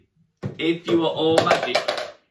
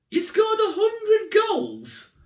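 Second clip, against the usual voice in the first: higher, louder slow shout of amazement; amazed; 14 semitones higher, 3 dB louder, much slower than usual. Acted. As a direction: act wildly impressed. Mock amazement at child's claim.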